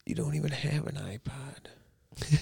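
slow, hushed aside; whisper. Real, not acted. Calling out a friend's iPod lie, whispering. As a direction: whisper it slowly, almost without voice.

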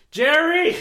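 very high, loud call; shouted name. Pretend. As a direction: yell the name across the room. Calling out to someone.

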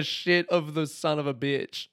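normal talking voice

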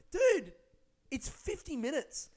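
high, incredulous "dude"; exasperated retort. Retold or imitated. Comic reacts to the man moaning about fifty minutes, exasperated disbelief. His exasperated reply to the complainer.

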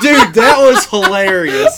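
real laughter through loud, high speech; helplessly amused, laughing words out. Real, not acted. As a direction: crack up while talking. Cracking up at the retold scene.

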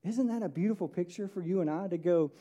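ordinary delivery

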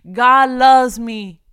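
exultant preaching; joyful proclamation. Acted. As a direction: shout the good news about yourself with a laugh. Joyful declaration of God's love.

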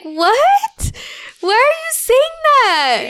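high, loud, incredulous question; mock disbelief. Mother reacting to her daughter's odd words, incredulous. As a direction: exclaim it in exaggerated shock.

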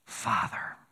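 whispered, tender Father; Jesus shows how to begin, whispered; intimate whisper. Retold or imitated. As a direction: breathe the word Father close and soft. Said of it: "Here's how you start it".

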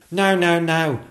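high, loud protest; crying out. Acted. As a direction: cut in urgently. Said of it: "cried the second footman".

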